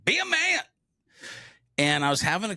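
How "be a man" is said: much higher mocking "be a man"; mock tough-guy slogan. Mocking the advice he grew up with: high sarcastic quote. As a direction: parody the macho cliché. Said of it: "I've subscribed to the man up".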